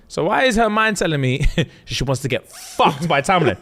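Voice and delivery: high, scandalised teasing through laughter; hammed-up taunt, breaking into laughs; mock incredulous taunting. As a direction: build into a high, drawn-out jeer. Acted taunt, laughing as he tells.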